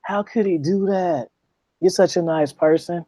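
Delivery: imitating a gushing, sympathetic friend; hammed-up sympathy; mock sympathy. Mocking a sympathetic listener.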